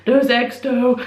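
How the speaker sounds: a foodie's happy sigh at the memory of eggs; relishing. Real, not acted. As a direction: dwell on eggs like a treat. Fondly recalling the breakfast.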